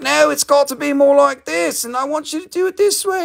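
high, fussy demanding customer; mock a pushy client; bossy customer. Seller mimics pushy customers with a high fussy voice. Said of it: "Other people are like".

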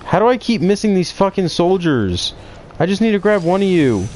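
high, loud gamer rage; frustrated yelling. Real, not acted. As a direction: yell at the game in frustration. Missing enemies in game.

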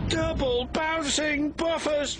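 high, slow shrieking cry; terrified shriek. Acted. Percy shrieking as the mine sinks. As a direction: shriek in terror. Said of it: "shrieked Percy".

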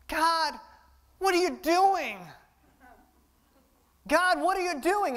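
high, strained whine at God; mock a frustrated toddler; whiny complaint to God. Preacher mimicking people whining at God. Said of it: "Anybody ever sound like that?".

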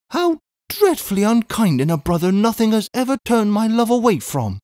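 high, plaintive reproach; wounded cry. A narrator's reading. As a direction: protest high, like a hurt sister. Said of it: "cried Miss Pross".

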